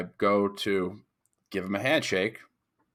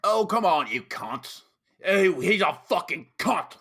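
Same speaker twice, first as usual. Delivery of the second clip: high, punchy mock British accent; British lad swearing; 9 semitones higher than usual. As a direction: imitate a rowdy British bloke. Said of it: "They say it in a very".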